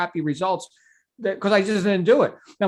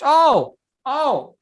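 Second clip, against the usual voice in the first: sudden realisation; 8 semitones higher, 6 dB louder than usual. Acted. Coach acting out remembering a recommended book, sudden realisation. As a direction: hit on the answer with an acted eureka.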